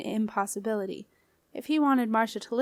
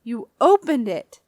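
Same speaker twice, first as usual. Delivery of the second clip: loud, slow accusing outburst; aunt catching the boy out, angry and loud; 2 semitones higher, 9 dB louder than usual. A narrator's reading. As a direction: hurl it at him, loud and slow. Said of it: "she said angrily".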